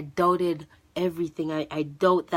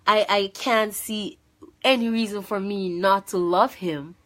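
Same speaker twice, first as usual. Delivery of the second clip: ordinary delivery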